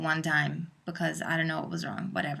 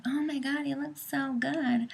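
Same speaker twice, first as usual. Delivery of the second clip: gushing commenter; 6 semitones higher, 4 dB quieter than usual. Retold or imitated. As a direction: coo a compliment in a put-on high voice. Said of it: "somebody will comment and be like".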